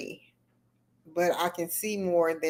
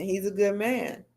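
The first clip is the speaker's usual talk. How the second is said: ordinary talk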